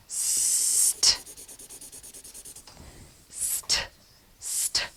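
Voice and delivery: st breathed without voice; teacher isolating the st sound; whispered cluster sound. Shown as a demonstration. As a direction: hiss out st softly for the class. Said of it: "consonants together making that sound".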